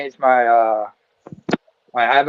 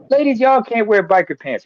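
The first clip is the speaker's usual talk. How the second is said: imitating comedian Pierre; 7 semitones higher than usual. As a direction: quote him like a standup bit. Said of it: "He said, like".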